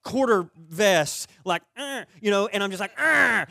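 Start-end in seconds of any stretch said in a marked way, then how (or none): 1.8-2.0 frustrated growl of "uh"; angry grunt. Retold or imitated. Golfer fuming over a missed shot, angry grunt. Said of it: "angry because I could not". 3.0-3.4 loud, high-pitched frustrated "uh"; angry grunt. Retold or imitated. Golfer fuming over a missed shot, loud angry grunt. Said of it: "angry because I could not".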